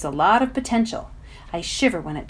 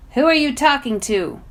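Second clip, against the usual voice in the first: suspicious; 5 semitones higher, 5 dB louder than usual. Acted. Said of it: "the boy asks suspiciously".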